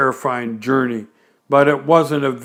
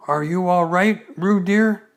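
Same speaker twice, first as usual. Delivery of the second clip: higher, tender motherly check-in; lightly voiced mother; 5 semitones higher than usual. Kanga asking Roo in her pocket, tender and higher. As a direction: coo gently to her baby.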